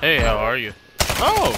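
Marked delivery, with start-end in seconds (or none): 1.2-1.5 taunting, sing-song high greeting; mock friendly greeting. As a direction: greet the enemy with fake cheer. Mock greeting to enemy player.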